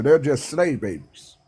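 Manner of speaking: ordinary talk, nothing marked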